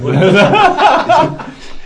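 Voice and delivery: high loud burst of laughter; real laughter. Real, not acted. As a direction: crack up at own joke. Laughs at own joke.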